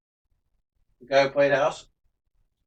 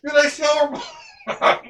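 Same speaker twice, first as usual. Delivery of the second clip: high teasing shout; 9 semitones higher than usual. Real, not acted. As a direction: yell the dumb question again.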